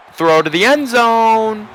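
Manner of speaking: high, loud, drawn-out announcer cry; excited play call. Real, not acted. Announcer calling a throw to end zone, excited. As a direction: stretch it out as the ball flies.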